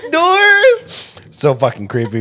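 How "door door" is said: high laughing chant. Real, not acted. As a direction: squeal the word through laughter. Laughing while repeating the chant.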